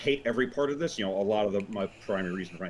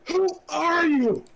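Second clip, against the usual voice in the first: high squeaky voice; 7 dB louder, much slower than usual. Acted. Repeat of character line.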